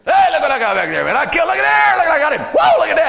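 high, loud whooping brag. Acted. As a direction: show off the truck-bed trophy. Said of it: "showing off that deer".

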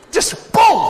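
high loud boom; sudden loud whack noise; impact sound. Pretend. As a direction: smack the sound out. Acting out the beheading.

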